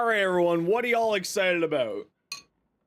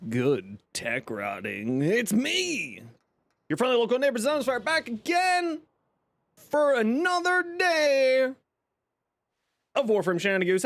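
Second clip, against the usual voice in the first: stream intro; 6 semitones higher than usual. Acted. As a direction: greet the stream like a showman. Stream intro greeting routine.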